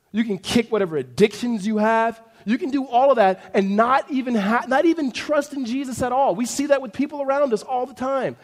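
impassioned preaching. Acted. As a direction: press the point with mounting force.

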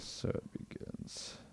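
slow, soft, breathy trailer whisper; ominous song-title announcement; dramatic trailer voice. Acted. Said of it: "You like how I say that?".

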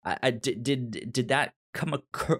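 ordinary talk, nothing marked

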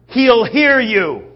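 slow, high, loud scolding; crowd's loud warning. Acted. Acted crowd scolding the yelling beggar.

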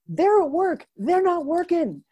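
high, loud, emphatic retort; exasperated retort. Retold or imitated. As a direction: push back, high and insistent. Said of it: "And I'm like".

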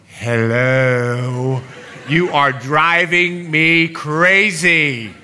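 slow, laughing mock exasperation; exasperated. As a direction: drag it out, half laughing, fed up. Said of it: "I want to go".

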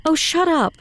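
high-pitched, fed-up comeback; exasperated retort. Acted. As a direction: jump up in pitch to cut him off. Marjorie snaps back in quarrel.

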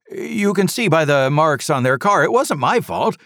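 high, loud, defensive protest; loud blustering driver. A narrator's reading. Driver shouting at the trooper, loud and defensive. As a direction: insist loudly it wasn't your fault. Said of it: "talking loudly".

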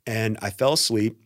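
ordinary talk, nothing marked

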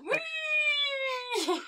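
long, high-pitched squeal held on one note; playful whee. Pretend. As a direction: squeal like a kid on a roller coaster. Player on the roller coaster.

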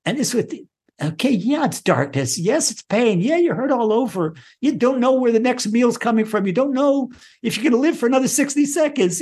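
high, rising preacher's fervour; impassioned sermon voice; fervent preaching. Acted. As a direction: preach it with mounting passion. Impassioned teaching.

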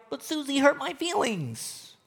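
high, soft mock whine; hammed-up childish hurt; whiny complainer. Believer complaining about Susie, whiny. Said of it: "And we're like".

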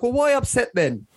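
high, squeaky mock-innocent questions; feigning total confusion, voice jumping high; baffled innocence. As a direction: play dumb, pitching way up in disbelief. Retold confused reply to girlfriend.

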